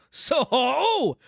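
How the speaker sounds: high ringing hail; loud hail. A narrator's reading. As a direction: roar it out across the road. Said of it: "sang out as loud as he could roar".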